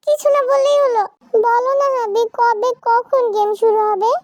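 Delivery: put-on high, insistent girlish wheedling; pleading child wife. Zara begging her husband about the game, high and insistent. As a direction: pester for an answer in a high voice.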